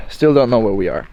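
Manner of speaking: ordinary delivery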